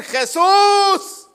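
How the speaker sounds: high, loud drawn-out shout; long, desperate yell; crying out. Acted. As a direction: cry out the name like a blind man pleading. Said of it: "Más gritó".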